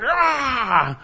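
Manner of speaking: a hammed-up bitter cry of a grown man. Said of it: "this hairy man going".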